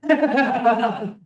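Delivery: laughing through the words. Real, not acted. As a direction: talk while cracking up. Laughing while telling.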